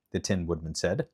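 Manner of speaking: usual speaking voice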